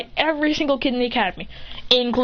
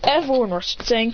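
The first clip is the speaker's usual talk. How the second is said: ordinary delivery, nothing marked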